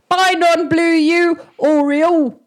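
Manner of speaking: high, loud farewell shout; calling goodbye. Acted. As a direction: call your goodbye across a distance. Calling a farewell across the distance.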